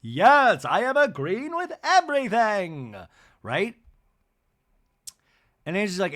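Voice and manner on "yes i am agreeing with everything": vehement, over-the-top agreement; high, loud caricature of eager agreement; Bronwyn. Retold or imitated. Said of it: "nods vehemently in agreement".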